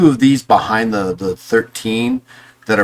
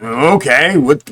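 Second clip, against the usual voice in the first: ordinary delivery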